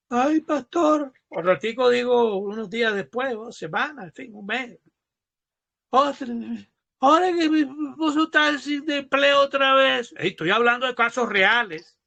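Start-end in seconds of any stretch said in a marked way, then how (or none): 0.1-1.1 high, drawn-out imitated wail; slow mock lament to the pastor; mock tearful plea. Acted churchgoer back with same plea. 5.9-10.1 high-pitched imitated whine; hammed-up lament to the pastor; mock tearful plea. Acted churchgoer wailing about lost job.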